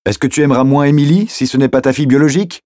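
arguing loudly over traffic noise; heated challenge. Acted. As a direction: challenge him urgently, pitched well up.

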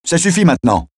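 shouting. Acted. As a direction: cut him off with a sharp cry. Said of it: "cria-t-elle". (cri aigu pour l'arrêter)

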